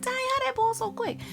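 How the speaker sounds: high-pitched mock dismay; retold exasperated surprise. Exclaims at pot boiling over.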